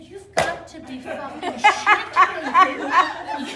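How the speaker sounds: slow, high shout through laughter; comic indignant wail; outraged disbelief. Retold or imitated. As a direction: draw out each word, climbing to a yell. Acted outrage at being told weight gain.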